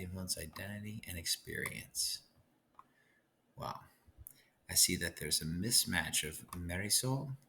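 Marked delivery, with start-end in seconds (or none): none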